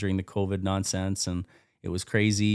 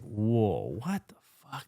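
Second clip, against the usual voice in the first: stunned disbelief; slower than usual. Retold or imitated. Acts out stunned reaction to views.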